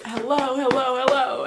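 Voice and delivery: loud, impatient repeated calling. Retold or imitated. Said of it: "You start getting louder and angrier".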